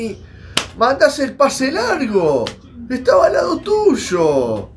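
exasperated yelling. Real, not acted. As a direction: urge the player to pass. Yells at player not passing. (gritando exasperado a la pantalla)

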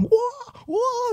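very high, held crowd scream, kept soft; teriakan penonton tiruan. Retold or imitated. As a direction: mimic screaming fans at a distance, high but quiet. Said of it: "terus penonton belakang". (tiruan jeritan penonton, melengking tapi pelan)

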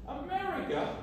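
a high, incredulous echo of "America?"; stammered disbelief. Retold or imitated. Retelling the argument, he echoes the mate's suggestion in high disbelief. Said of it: "I stammered in disbelief".

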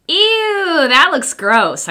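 high squealed ew, drawn out slowly; a kid disgusted by medicine; mock-disgusted little girl; grossed out. Girl's thought, disgusted.

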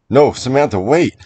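panicked plea to wait; alarmed warning. Acted. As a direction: stop her before she goes in. Warns wife entering the barn.

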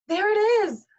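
excited high call from afar. A narrator's reading. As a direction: call it out eagerly, loud and high. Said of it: "called Ming Chu to her parents".

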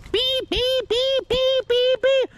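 very high, even car beeps; imitated alarm beeps. Driver mocking the seatbelt warning, high imitated beeping. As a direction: mimic a piercing seatbelt chime.

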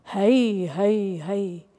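long drawn-out falling heys; spooky cry. Acted. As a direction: wail it out like a spook in the woods. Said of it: "ganz gnaucht an Schrohr". (langgezogener Spukruf)

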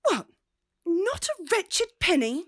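shocked, indignant cry; outraged disbelief. Acted. Marcia reading her father's letter, outraged disbelief. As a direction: pitch leaps way up on the question.

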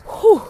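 high, loud exhaled whew; winded whew. Real, not acted. Out of breath from walking, loud winded whew. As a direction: blow out hard, winded. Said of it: "I am out of breath".

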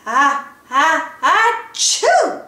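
rising, building sneeze; big sneeze. A narrator's reading. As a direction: wind up and sneeze hard.

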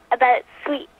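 put-on a light Scottish accent on About sweets. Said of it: "I'm doing my Scottish accent now".

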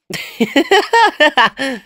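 high bright burst of laughter; hearty laugh. Acted. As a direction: laugh out freely. Laugh alone on a line.

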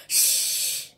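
breathy hushing shh; whispered shush. Acted. Before the bedtime surprise, a whispered shush. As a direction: hush them for the surprise.

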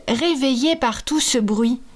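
ordinary delivery, nothing marked

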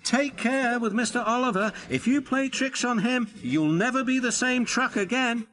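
high, insistent truck voice; trucks warning. A narrator's reading. Trucks warning each other about Oliver, high and urgent. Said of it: "quick to warn each other".

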